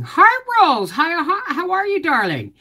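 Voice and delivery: high, cooing hello; sweet greeting. Real, not acted. Greeting Rose as she arrives, high cooing. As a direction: coo at her fondly.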